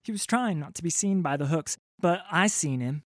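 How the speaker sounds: put-on high, nervous boy voice; a scared lad blurting his news; frightened urchin. Urchin reporting to the pirate captain, high and nervous.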